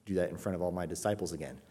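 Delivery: soft, low private reproof; hushed, polite pleading; very gentle. Acted. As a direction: ask it gently, keeping the voice down. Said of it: "and speak to him very gently".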